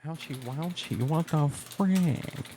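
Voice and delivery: high whiny ouchie, slow finish; mock whiny pain. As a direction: play up a fake hurt. Mock pain after punch.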